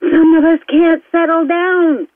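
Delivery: high, slow, stretched-out words; slow right down and pitch it up for the fast-talk complaint; exaggeratedly slow, high delivery; slowed for listeners. Shown as a demonstration. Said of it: "I know I'm talking too fast for some of you".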